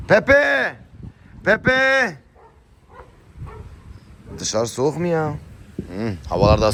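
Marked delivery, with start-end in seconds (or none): 0.1-2.2 high, loud call across the yard. Real, not acted. Owner calling her dog Pepe across the yard, a high loud call. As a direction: call the dog's name, pitched way up.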